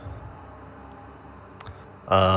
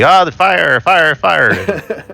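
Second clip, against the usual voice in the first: raised, higher-pitched cry of alarm; mock alarm yell; 9 semitones higher, 4 dB louder, much slower than usual. Player voices Tom raising a fake fire alarm, mock yell. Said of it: "just yells really loudly".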